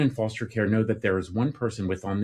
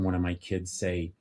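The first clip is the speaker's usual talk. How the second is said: normal talking voice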